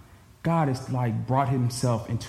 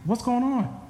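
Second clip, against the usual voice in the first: baffled; 9 semitones higher, slower than usual. Retold or imitated. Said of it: "And I'm like".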